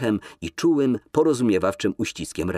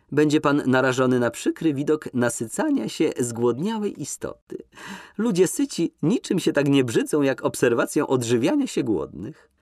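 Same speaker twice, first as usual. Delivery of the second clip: higher, lilting flirtatious lady; coquettish Nina; 7 semitones higher than usual. Acted. Nina teases Kolski in the limousine, high and coquettish. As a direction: lift her voice, coy and teasing.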